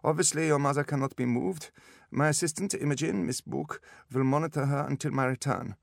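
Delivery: put-on high-pitched German-accented specialist; businesslike instructions in a German accent; brisk German doctor. A narrator's reading. German doctor figure speaks.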